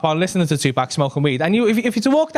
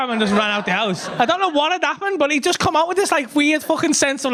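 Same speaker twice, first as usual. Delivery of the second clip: usual speaking voice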